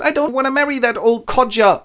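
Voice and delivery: high-pitched reluctant bride; whining refusal. Acted. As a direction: protest like a put-upon young woman. Young woman refuses match.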